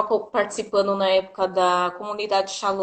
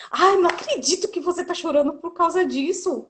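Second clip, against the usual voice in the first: higher, lightly voiced exasperated friend; astonished friend; 7 semitones higher than usual. Friend finds Gi crying over a pregnancy test, higher mock disbelief. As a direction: play the friend who can't believe it. Friend scolding her tears, acted quote.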